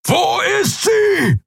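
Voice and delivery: furious, drawn-out roar. A narrator's reading. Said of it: "brüllte er".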